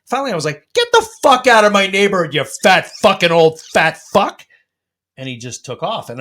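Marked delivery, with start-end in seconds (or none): 0.7-4.4 loud, high yell, slowing on the insult; re-enacted bellow at a driver; angry shout. Resident chasing a driver out of the neighborhood, angry shout. Retelling yelling at truck driver.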